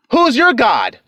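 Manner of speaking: normal talking voice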